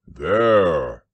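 loud, growly one-word announcement; proud bear. A narrator's reading. Pooh showing off his boat, proud. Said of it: "pointing proudly".

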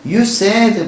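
a hint of a protesting student; mock outcry. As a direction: call it out like an upset kid. Voicing angry student in dialogue.

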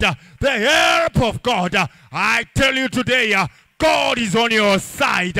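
loud, rising sermon cadence over the music; preaching at full force. Acted. Pastor rallying the congregation, loud preaching. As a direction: preach it to the whole church.

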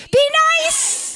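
high-pitched called-out plea; mock scolding. Real, not acted. Storyteller answering her audience, high mock scolding. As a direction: call it out high and playful.

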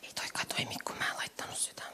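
soft unvoiced whisper to herself; whispered aside. Real, not acted. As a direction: whisper it under your breath as the slide fails. Mutters to self, slide fails. (kuiskattu sivuhuomautus itselle)

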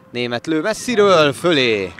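loud call of a long shot; excited sports commentary. Real, not acted. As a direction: shout the shot, then drop. Long-range shot goes over.

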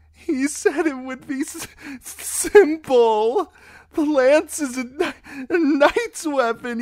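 high, loud, stammering sob; weeping. A narrator's reading. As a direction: stutter the tale out through tears. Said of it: "Stop that weeping".

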